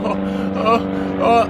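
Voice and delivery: high, repeated mock sobs; imitated crying. Waking from dad dream, imitated sobbing. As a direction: act out helpless wailing in moans. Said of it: "I couldn't stop crying".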